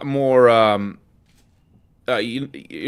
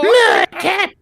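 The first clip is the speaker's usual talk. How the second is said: put-on old lady voice; 5 dB louder than usual. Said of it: "All right, I'll voice her".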